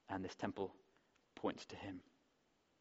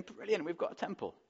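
much higher, eager and bright-eyed; lightly acted youthful cheer; excited youngster; 9 semitones higher than usual. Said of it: "The youngsters are going".

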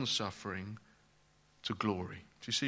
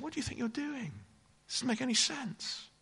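inner voice; 10 semitones higher, 5 dB quieter than usual. Retold or imitated. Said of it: "when you hear that voice".

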